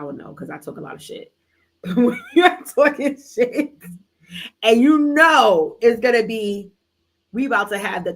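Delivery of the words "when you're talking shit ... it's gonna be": laughing. Real, not acted. Laughing about fighting with her man, loud and slow.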